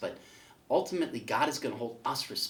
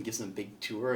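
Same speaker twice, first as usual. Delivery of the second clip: ordinary delivery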